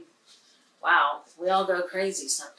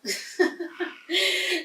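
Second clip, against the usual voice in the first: high laugh; 10 semitones higher than usual. Real, not acted. Laughs at own joke.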